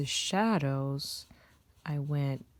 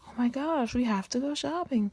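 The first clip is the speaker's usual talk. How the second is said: higher and louder, playful shopping-mad squeal; imitating an eager shopper, a bit louder and higher; mock excited gush; 7 semitones higher, 3 dB louder than usual. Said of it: "It's not like a".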